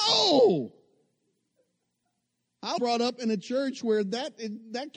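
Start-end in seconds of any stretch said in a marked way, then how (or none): 0.1-0.7 high, loud horrified no; a sudden loud inner protest; shocked no. Retold or imitated. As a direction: shout it in disbelief. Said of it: "And I thought".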